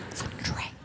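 whispered aside, almost no voice; conspiratorial whisper. Real, not acted. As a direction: let the audience in on the secret. Punchline repeated louder then whispered.

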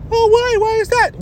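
high-pitched imitation of a questioning wife; high mimicking falsetto; mock wife voice. Acting out wife's questioning.

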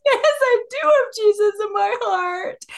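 put-on high, slow toddler voice, insisting; insistent little one. Toddler insisting to his older brother, high and slow. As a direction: play the almost-three-year-old digging in, high and drawn out. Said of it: "And the little one goes".

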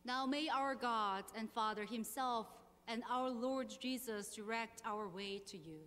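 ordinary delivery

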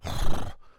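loud horse-like snort, no voice; horse noise. Acted. Charlotte coming round after the fall, horse noise. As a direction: blow out noisily like a horse. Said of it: "made a noise like a horse".

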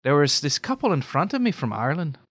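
storytelling in an Irish lilt; Irish brogue with pitch swinging up at phrase ends; Irish accent. Shown as a demonstration. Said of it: "Can you go right into it".

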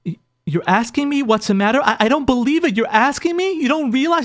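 imitating an incredulous wife, pitch climbing; retold affronted wife; resentful disbelief. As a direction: act out hurt indignation, stammering at first. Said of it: "she's, like, resentful".

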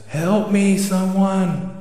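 high, pleading call; calling for help. Acted. Owl about to fall from the branch, calling for help. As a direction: cry out for help, pitched well up.

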